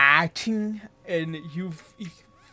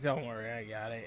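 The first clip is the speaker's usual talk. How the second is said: put-on quiet, quick drunken mumble; slurring it like a tipsy elf; 6 semitones lower, 10 dB quieter, faster than usual. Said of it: "raises her hand drunkenly".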